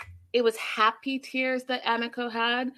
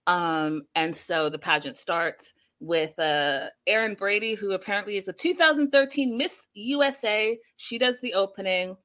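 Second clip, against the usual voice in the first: usual speaking voice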